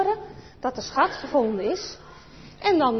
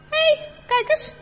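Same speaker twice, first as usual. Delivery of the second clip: high, loud, slow cry of discovery; a kid who just found a clue, pitched high; excited discovery; 6 dB louder, slower than usual. Acted. Chris to Anneke, high loud excited discovery.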